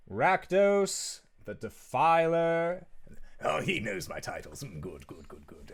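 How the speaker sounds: savoring his own titles; preening demon. Acted. Rakdos preening.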